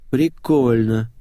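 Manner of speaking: slow, stretched-out word; drawn out. A narrator's reading. Ната reacting to the swapped phones, drawling. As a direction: draw the word out slowly. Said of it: "протянула Ната".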